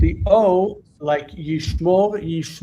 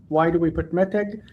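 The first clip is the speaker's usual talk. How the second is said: normal talking voice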